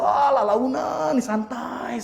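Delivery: high, drawn-out slow words; playfully exaggerated leisurely pace; slow and careful. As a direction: stretch it out to mime very careful driving. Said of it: "sangat hati-hati".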